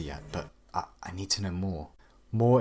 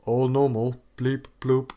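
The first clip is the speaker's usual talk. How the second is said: slow innocent robot beeps; fake robot voice; 5 semitones higher, 7 dB louder, slower than usual. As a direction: insist you're a normal robot. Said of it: "very rehearsed computer noises".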